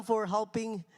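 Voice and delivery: usual speaking voice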